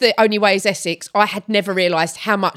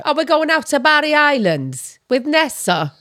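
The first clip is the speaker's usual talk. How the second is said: high, sing-song Welsh lilt; Welsh accent; 5 semitones higher than usual. Shown as a demonstration. Continuing the Welsh demo, high Welsh accent. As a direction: play it up in higher-pitched Welsh. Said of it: "Five, Welsh".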